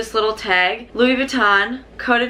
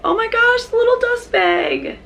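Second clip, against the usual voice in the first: excited squeal; 12 semitones higher than usual. Real, not acted. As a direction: squeal over the tiny bag. Squealing at the dust bag.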